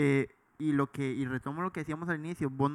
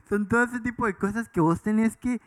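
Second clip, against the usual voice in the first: laughing; 5 semitones higher, 6 dB louder than usual. Real, not acted. Repeats line after laughing.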